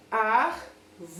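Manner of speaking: "Ar-vo-re" in three separate beats; syllable by syllable. Shown as a demonstration. Teacher counting syllables for pupils, separated syllables in "Ar-vo-re". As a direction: count out "Ar-vo-re" with a gap after each syllable. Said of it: "tem quantas sílabas?".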